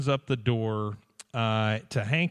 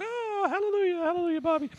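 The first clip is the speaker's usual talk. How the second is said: much higher, falsetto churchgoers' chant; mock crowd cheer. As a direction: chant it like a giddy congregation. Crowd voiced cheering for Bobby.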